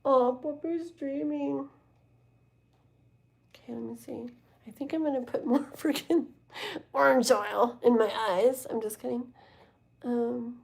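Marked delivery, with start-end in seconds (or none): none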